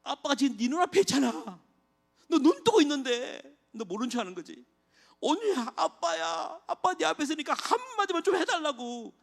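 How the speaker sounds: high, loud anguished cry; father screaming at his unresponsive daughter; desperate shouting. Retold or imitated. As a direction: yell at her to answer, frantic and breaking. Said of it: "제가 이렇게 막 소리를 질렀거든요".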